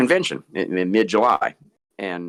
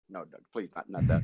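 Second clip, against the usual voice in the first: ordinary talk, nothing marked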